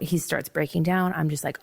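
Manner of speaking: usual speaking voice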